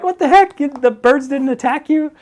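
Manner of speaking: high squeaky disbelief, slowing on the second line; retold disbelief. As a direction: sound stunned that the birds left her alone. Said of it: "And I'm like".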